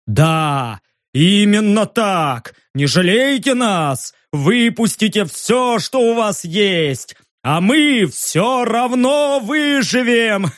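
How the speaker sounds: slow, high, wild defiant madman; crazed roar. A narrator's reading. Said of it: "взревел он".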